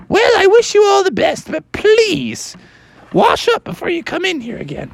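high, shrill scolding librarian; hammed-up high librarian; fussy librarian. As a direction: shoo them out in disgust.

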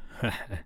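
soft, hollow chuckle; a quiet laugh with no joy. A narrator's reading. Hook laughing off his fear, soft hollow laugh. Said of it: "but in a hollow way".

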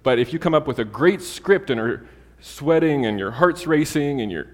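ordinary delivery

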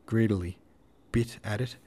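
ordinary delivery

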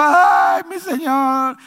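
a loud, high cry of anguish; pleading sob to the Lord; crying out to God. Acted. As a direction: wail it toward heaven. The king weeping to God.

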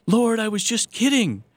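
high, loud mock protest; mock protest to God. Priest shaking his fist at the Lord, mock high loud protest. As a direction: cry out to heaven in comic panic.

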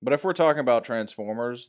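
ordinary talk

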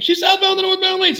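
high, loud, panicked shout into the radio; frantic radio call. Retold or imitated. Officer yelling the location over his radio, panicked. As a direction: blurt it out, all the cool gone. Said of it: "all the cool is gone now".

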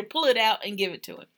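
ordinary delivery

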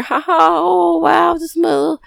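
sugary fake delight, slow; mock cooing. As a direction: coo over a cute fat pet. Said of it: "it's not cute".

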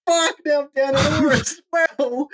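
high, drawn-out mock wail; hammed-up squeal of dismay; exasperated wail. Storyteller reliving the missed throw and lost oar, exasperated wail.

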